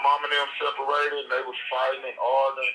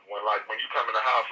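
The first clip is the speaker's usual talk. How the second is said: ordinary delivery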